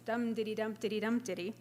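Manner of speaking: bouncing quick dum diddy beat; dactyl rhythm. Shown as a demonstration. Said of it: "strings of quick dactyls".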